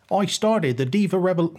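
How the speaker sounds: high-pitched, hammed-up mock accent; Emma's accent. Podcaster attempting the wrestler's accent, hammed-up accent. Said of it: "I'll do my Emma accent".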